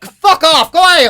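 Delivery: mock dismissal. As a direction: tell the cousin to clear off, hammed-up. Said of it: "And go".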